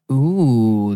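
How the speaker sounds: normal talking voice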